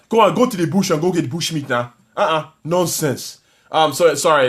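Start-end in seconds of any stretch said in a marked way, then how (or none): 0.1-3.4 put-on dismissive Nigerian accent. Teasing Nigerian viewers with a dismissive Nigerian accent. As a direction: wave them off in a Nigerian accent. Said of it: "I love doing African accents".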